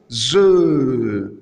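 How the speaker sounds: long, emphatic buzzing "ze", falling away; demonstrated sound. Shown as a demonstration. Teacher showing how the German S is pronounced, demonstrated sound. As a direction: draw out "ze" as a voiced z sound. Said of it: "se prononcent".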